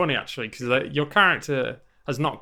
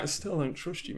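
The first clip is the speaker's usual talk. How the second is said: very soft repeated refusal; Saeed; 12 dB quieter than usual. Retold or imitated. Saeed refuses the player once more, quietly distrustful. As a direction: drop the volume right down, unmoved. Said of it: "Saeed's like".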